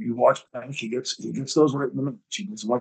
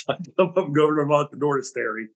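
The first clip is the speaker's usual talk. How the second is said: ordinary delivery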